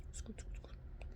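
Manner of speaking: breathless whisper; soft whispered sound effect; whispered scoot. Retold or imitated. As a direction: hush each scoot as if sneaking around nappers. Acting out scooting at nap time.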